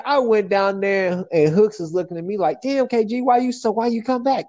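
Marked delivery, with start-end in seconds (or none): 2.6-4.5 higher-pitched mock confrontation; exasperated questioning. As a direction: imitate a friend demanding answers. Friend confronts him, retold.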